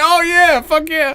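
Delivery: high-pitched joking whoop; mock cheer. Real, not acted. Friend cheering sarcastically about the wife-beater, high whoop.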